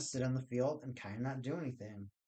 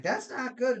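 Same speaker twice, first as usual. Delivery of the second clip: mock scold; 10 semitones higher, 7 dB louder than usual. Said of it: "they're like".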